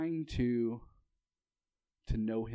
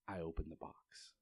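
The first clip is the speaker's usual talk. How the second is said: hushed whisper, sharing good news; whispered secret; 7 semitones lower, 13 dB quieter than usual. Retold or imitated. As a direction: breathe it like a happy secret. Cindy's joyful secret, retold.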